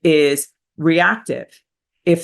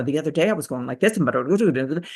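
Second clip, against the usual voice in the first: very fast, softer run-on chatter; rushed staccato; 2 semitones lower, 3 dB quieter, much faster than usual. Shown as a demonstration. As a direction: tumble the words out hurriedly in short bursts. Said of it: "you can hear a staccato rhythm".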